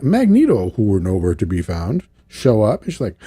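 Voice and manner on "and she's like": ordinary talk, nothing marked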